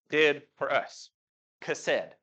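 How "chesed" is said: Chesed said as KHEH-sed, stress on first syllable. Shown as a demonstration. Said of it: "Y'all say chesed one more time".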